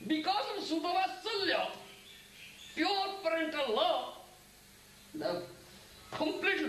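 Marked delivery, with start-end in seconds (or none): none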